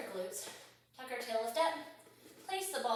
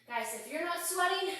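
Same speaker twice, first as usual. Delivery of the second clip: higher, puffed exercise talk; winded workout instructor; out of breath; 4 semitones higher, 6 dB louder than usual. Real, not acted. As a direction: talk through the exertion, out of breath. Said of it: "I am out of breath".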